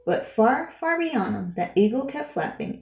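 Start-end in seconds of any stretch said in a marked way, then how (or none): none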